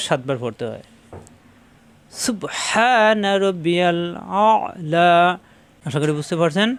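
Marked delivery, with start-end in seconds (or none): 2.1-5.1 very slow, drawn-out Arabic recitation. Shown as a demonstration. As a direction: stretch each sound of সুবহানা, pressed হা. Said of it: "সিজদার তাসবিহ". (ধীরে টেনে তেলাওয়াত)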